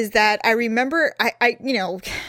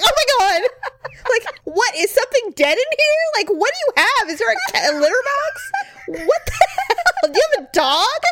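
high, loud grossed-out exclamations; recoiling from a stinky car, laughing; disgusted shock; 13 semitones higher, 3 dB louder than usual. Retold or imitated. Retelling to her sister, high disgusted shock at the smell. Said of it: "I was like".

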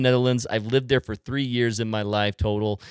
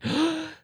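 high gasp of sudden recognition; mock gasp. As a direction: gasp as if retelling a surprise. Retold moment of recognizing Dutch.

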